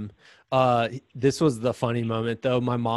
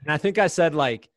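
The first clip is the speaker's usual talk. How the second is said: ordinary talk, nothing marked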